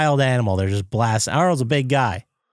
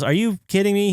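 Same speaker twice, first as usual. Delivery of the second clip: higher-pitched incredulous retort; mock disbelief; 9 semitones higher than usual. Boss answering the lab staff, mock incredulity. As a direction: imitate an exasperated boss, pitched up.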